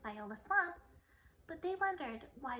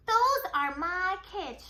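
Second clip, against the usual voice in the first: slow, loud, higher scratchy voice; 6 semitones higher, 12 dB louder, much slower than usual. A narrator's reading. Sick teacher seeing her class, slow scratchy voice. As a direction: rasp it out, shocked. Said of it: "she said in a scratchy voice".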